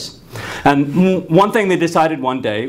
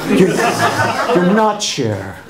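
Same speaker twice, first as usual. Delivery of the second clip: laughing; 3 semitones higher, much slower than usual. Retold or imitated. Teacher repeats, speaker laughing.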